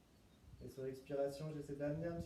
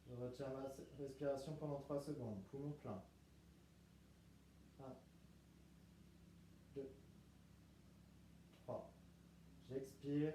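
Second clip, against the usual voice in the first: guided meditation; 2 semitones lower, 7 dB quieter than usual. Acted. Leads breathing in relaxation.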